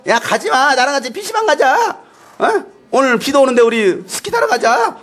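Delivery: coaxing friend. Acted. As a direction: tempt a pal to come play. Acting friends luring believer away. (들뜬 목소리로 꼬드기듯)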